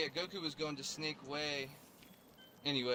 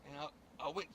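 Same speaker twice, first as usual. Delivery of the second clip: ordinary talk, nothing marked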